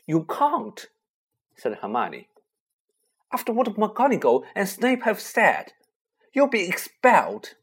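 high alarmed protest. Acted. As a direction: warn him in alarm.

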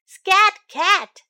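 high, loud hooting shoo; an owl in the night chasing off the cat. Acted.

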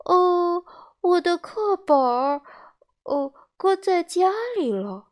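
put-on high, hesitant child voice; caught-out little boy fumbling for words; flustered puppet boy. A narrator's reading. Pinocchio lies about textbook.

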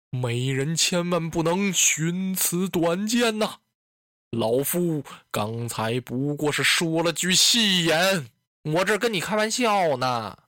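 slow, drawn-out coaxing; unhurried, stretched-out pleading of an aging lord; placating old lord. Acted. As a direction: play an old master soothing his concubine. Voices Dong Zhuo placating Diaochan.

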